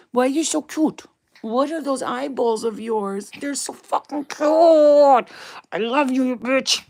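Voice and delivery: high, loud, stretched-out cooing; squealing adoration at a pet. Real, not acted. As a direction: smother the puppy in over-the-top affection. Gushing over the dog.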